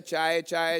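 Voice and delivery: low, softened vendor's call; affectionate tea-seller. Retold or imitated. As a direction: coax customers sweetly, pitched well down. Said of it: "पहले प्यार से बोलता है". (प्यार से, धीमी नीची आवाज़ में चायवाले की पुकार)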